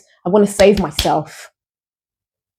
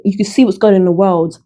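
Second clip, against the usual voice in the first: usual speaking voice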